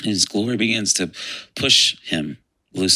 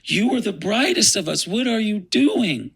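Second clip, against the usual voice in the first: much higher, pleading cry of disbelief; emotional anguish; 13 semitones higher than usual. Retold or imitated. Gabriel confronting the fallen Lucifer, emotional and anguished. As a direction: plead in distraught, high-pitched shock. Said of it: "who was more emotional".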